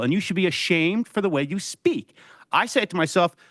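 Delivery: ordinary delivery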